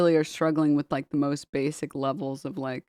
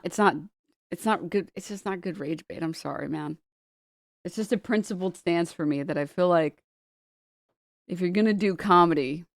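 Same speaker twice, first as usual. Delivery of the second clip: ordinary talk, nothing marked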